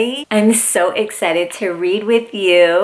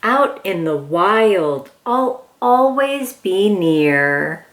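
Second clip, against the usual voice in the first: storybook reading; 3 semitones lower, slower than usual. Acted. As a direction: read it gently like bedtime to a toddler. Reads picture book aloud.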